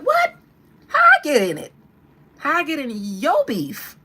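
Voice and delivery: high, loud mock-shocked [name]. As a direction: squeal it, playing a stunned [name]. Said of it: "[name] was like".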